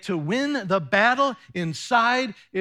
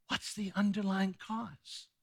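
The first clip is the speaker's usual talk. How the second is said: hushed question; 9 dB quieter than usual. Acted. Repeated question, much quieter.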